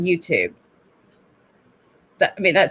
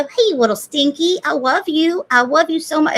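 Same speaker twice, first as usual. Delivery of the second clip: high, sing-song cooing; baby talk; 8 semitones higher than usual. Retold or imitated. Mother cooing love to her little son. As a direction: dote on the little one. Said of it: "I'd always go".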